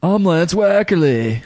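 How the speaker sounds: high, slow surfer drawl; laid-back California surfer voice; California surfer dude. Acted. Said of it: "That was my California surfer dude voice".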